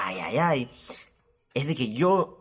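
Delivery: ordinary talk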